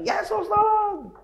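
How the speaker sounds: very high, long drawn-out holler; insistent acted yelling. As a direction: stretch the plea out in a high yell. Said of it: "makin teriak kencang".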